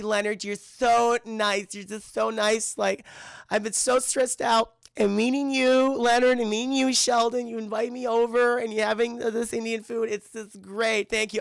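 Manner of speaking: high, drawn-out, whiny thanks; imitation of emotional, overwhelmed thanking; mock weepy gratitude. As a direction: gush as if still tearful. Voices tearful Penny thanking the men.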